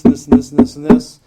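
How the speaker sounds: relentless list, each "this" hit hard; piled-up demands. Retold or imitated. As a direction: rattle off a list, punching each "this" loudly. Piling demands on newcomers.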